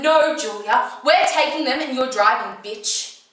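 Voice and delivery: loud, insistent refusal, acted out; heated argument. Arguing with Julia over the car keys, heated. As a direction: snap it out loud and forceful.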